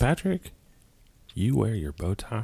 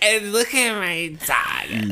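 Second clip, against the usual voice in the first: mocking; 11 semitones higher, much slower than usual. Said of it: "And she's like".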